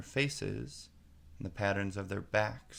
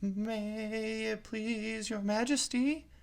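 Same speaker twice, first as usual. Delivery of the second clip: much higher, slow, timid pleading; very humble; 13 semitones higher, much slower than usual. A narrator's reading. Gardener pleads humbly on one knee before the queen. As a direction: kneel and grovel. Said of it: "in a very humble tone".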